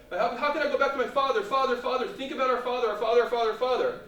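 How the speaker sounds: fast, high, loud repeated begging; pleading. Acted. Brothers begging Joseph to think of father, insistent pleading. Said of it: "the brothers were pleading before Joseph".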